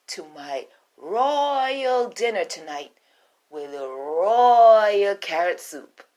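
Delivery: queen demanding carrots from the rabbit, loud and grand. Acted. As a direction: savor every royal word. Queen promises and invites.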